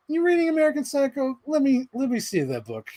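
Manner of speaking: high, mock eager voice; imitated classmate. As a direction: pitch it way up as a nosy classmate. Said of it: "Adam Steves is always like".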